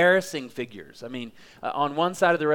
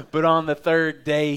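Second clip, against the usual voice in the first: louder, ringing proclamation; proclaimed; 5 dB louder than usual. Shown as a demonstration. Preacher proclaiming the resurrection to the church, loud. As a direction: burst out with it, full voice. Loud repeat after the whisper.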